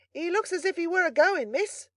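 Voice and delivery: high, loud servant's call; carrying bawl. A narrator's reading. Servant reporting on the wounded boy to miss, high bawling. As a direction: bawl it up the stairs. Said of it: "bawled Brittles in the same manner".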